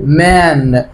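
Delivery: ordinary talk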